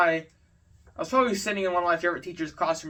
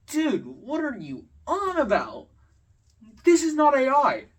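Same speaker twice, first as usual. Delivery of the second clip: exasperated retort; 7 semitones higher than usual. Retold or imitated. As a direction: push back hard on his nonsense. Retold argument with classmate.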